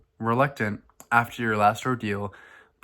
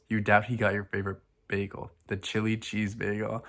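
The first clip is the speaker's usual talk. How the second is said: ordinary delivery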